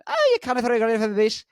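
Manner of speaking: put-on high, loud, rapid Scottish accent; Scottish relative. Relative in Scotland scolding, fast thick Scottish voice. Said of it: "one of my relatives said".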